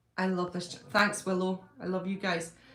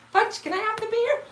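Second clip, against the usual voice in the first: high, louder wheedling voice for the dog; pet talk; 13 semitones higher, 5 dB louder than usual. Real, not acted. Owner pleading with her dog Fudge for the chewed bear, high coaxing pet talk. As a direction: sweet-talk the pup into giving up the toy.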